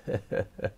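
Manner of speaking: soft unvoiced chuckle; breathy frog laughter. A narrator's reading. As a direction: giggle quietly at the wobbling fish. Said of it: "He couldn't stop laughing".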